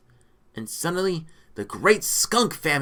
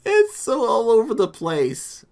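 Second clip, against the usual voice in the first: high, loud, just out of laughter; laughing exasperation; 9 semitones higher, 7 dB louder than usual. Real, not acted. Reader exclaiming about the messy story, amused. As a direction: say it loudly, still cracking up.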